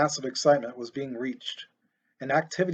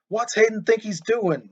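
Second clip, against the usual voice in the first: higher, angry outburst at the TV; raging fan; 7 semitones higher, 3 dB louder than usual. A narrator's reading. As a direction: rage at the bad play. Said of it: "raged Shaw".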